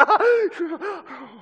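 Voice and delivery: high, loud scream of fright; startled yelp. Acted. Man finding a snake in his shed, startled scream. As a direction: shriek as if a snake just surprised you.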